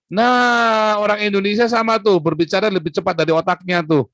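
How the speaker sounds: sudden high, loud burst of emphasis; excited. Real, not acted. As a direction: punch the point home, fired up. Punchline about speaking before thinking.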